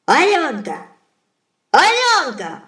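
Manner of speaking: high, loud calling shout; Baba Yaga's shout. Acted. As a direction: bellow for the daughter across the hut. Baba Yaga calls her daughter.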